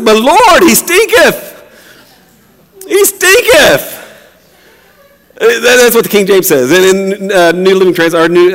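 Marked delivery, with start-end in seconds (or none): 0.0-4.0 Martha. Acted. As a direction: squeal it out, stretching the last complaint. Playing Martha protesting about the smell.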